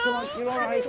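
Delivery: high, loud, shaken comforting; calming someone while scared yourself; frantic reassurance. Real, not acted. Medium urgently calming Ivy after the crash, high and loud.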